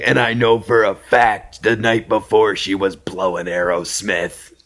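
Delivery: slow put-on boastful voice; Manny's voice. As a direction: brag slowly in his voice. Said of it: "and he talked like this".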